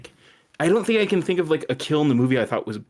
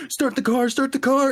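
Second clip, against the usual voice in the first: imitated yelling; 14 semitones higher than usual. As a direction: imitate panicked yelling in a raised pitch. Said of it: "they're yelling".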